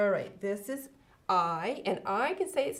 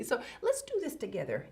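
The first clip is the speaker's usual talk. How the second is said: ordinary talk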